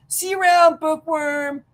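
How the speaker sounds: mocking taunt. Bully taunt voiced higher.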